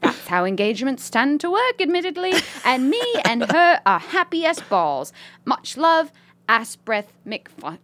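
put-on British lady accent. Reading a listener's email as a British lady, British accent. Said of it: "British lady writing this email".

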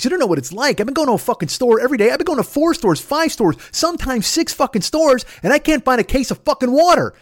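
worked-up tirade climbing high and staying there; retold angry rant. Telling off his brother in the store, angry rant. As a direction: reenact a rage, pitch jumping way up. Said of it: "spark of rage deep inside me".

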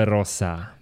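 ordinary delivery

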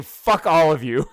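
loud hammed-up defiance; mock shout. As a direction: shout it like a smug company.

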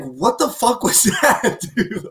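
high, slow, laughing mock confusion; baffled Kyle Anderson. Imitating Kyle Anderson's baffled reaction, high and slow. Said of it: "Kyle Anderson just be like".

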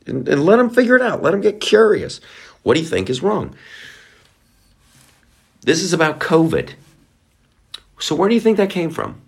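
high, faux-curious questions; a sing-song, mock wondering voice; mock innocent questioner. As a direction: play dumb to bait a friend.

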